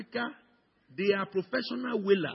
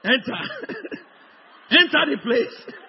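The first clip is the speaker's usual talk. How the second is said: mock command; 7 semitones higher, 8 dB louder than usual. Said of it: "and bury them".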